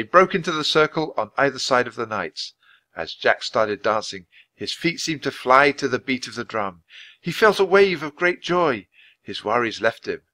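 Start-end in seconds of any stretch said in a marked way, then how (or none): none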